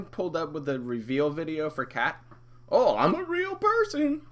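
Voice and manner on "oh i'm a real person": high squeaky mock falsetto; mock girly voice. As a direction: squeak it like a mocked girlfriend. Mimicking girlfriend in reveal video.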